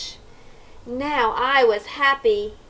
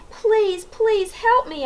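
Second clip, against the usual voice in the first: begging; 10 semitones higher, slower than usual. A narrator's reading. Boy begs Mister Carp.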